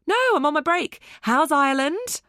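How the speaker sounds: bright chatty friend on her break; Zoe's voice. A narrator's reading. Zoe chatting cheerfully to her friend on the phone.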